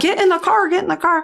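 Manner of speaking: high, frantic urging; high rushed mock yell; urgent. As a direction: hurry him in, frantic. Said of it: "I'm practically, like, feet and all hands".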